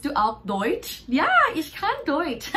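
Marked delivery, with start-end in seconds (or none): 1.2-2.5 laughing. Real, not acted. Answering in German, laughing.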